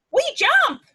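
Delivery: high, loud call; spring the word up like a frog; bouncy jump call. Acted. Baby frogs voiced in story.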